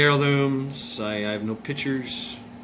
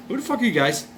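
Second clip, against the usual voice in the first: startled; 10 semitones higher, faster than usual. Retold or imitated. Kid confronting strangers at door.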